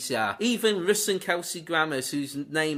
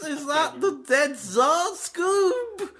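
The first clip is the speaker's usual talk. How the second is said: much higher, slower, giggling cartoon character; cartoon voice, laughing; 10 semitones higher, 4 dB louder, much slower than usual. Asking Scooby about the dead Tsar, in a high laughing cartoon voice. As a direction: ham up a shocked cartoon sidekick.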